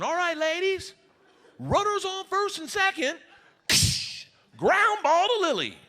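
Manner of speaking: high, announcing coach voice; coach calling plays. Retold or imitated. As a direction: call the imaginary play like a coach. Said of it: "coach calls imaginary plays".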